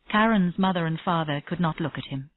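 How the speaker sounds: ordinary delivery, nothing marked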